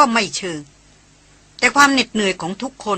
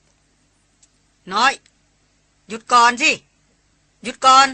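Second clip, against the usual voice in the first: called-out order; 3 semitones higher, 3 dB louder, much slower than usual. A narrator's reading. Elder brother to younger sister over radio, called-out order. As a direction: order to halt, building in volume. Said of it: "ร้องสั่งผ่านวิทยุไปว่า".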